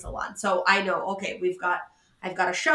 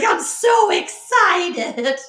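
drawn-out, much higher and louder mock glee; mock excitement; 9 semitones higher, 7 dB louder, much slower than usual. Husband teasing about seeing her on the news, mock excited. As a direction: stretch it out, teasing. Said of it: "He's like".

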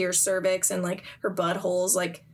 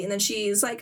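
normal talking voice

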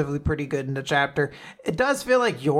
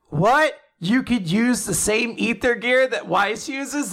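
higher-pitched mock astonishment; incredulous outburst; 7 semitones higher than usual. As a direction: act out exaggerated disbelief. Characters react in disbelief.

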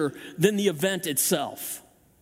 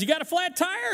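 mechanic asking; 9 semitones higher than usual. Retold or imitated. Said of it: "the guy says".

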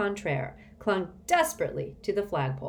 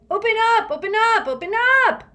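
very high, piercing repeated yell; shriek; 11 dB louder, faster than usual. A narrator's reading. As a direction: shriek over and over to be let in. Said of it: "shrieked Constance".

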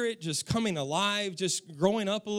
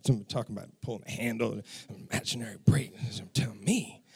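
whispered, lower inner grumble; seething whisper; 12 semitones lower, faster than usual. Retold or imitated. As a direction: hiss it to yourself behind her back.

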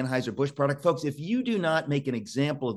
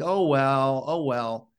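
mock shrug; 3 dB louder, slower than usual. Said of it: "we think".

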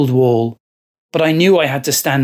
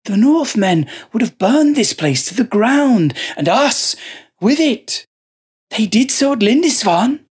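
a possessed monk, higher and measured; fervent monk; 7 semitones higher than usual. Acted. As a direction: justify it with calm conviction. Joseph defends himself.

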